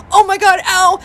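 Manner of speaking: high, loud pained cry; cramping pain. Retold or imitated. Wife runs past husband to bathroom, pained. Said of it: "I was in a lot of pain".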